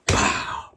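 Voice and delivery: unvoiced, loud smack sound; loud breathy slap effect; imitated smack. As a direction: spit out a sharp slap noise.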